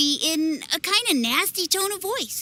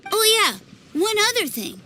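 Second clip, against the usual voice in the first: normal talking voice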